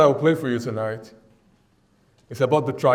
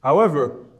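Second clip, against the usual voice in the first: normal talking voice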